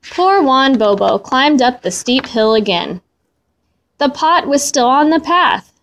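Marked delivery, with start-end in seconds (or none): none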